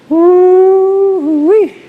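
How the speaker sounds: loud high whoop; woo-wee at the cold. Real, not acted. Cold shock exclamation.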